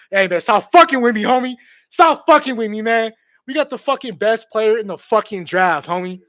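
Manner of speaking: excited yelling. Real, not acted. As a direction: yell it, giddy with disbelief. Overjoyed fan yelling at stream.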